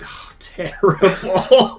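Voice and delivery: slow laughing scorn. Real, not acted. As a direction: laugh it out dismissively. Laughs at Amy Schumer jokes.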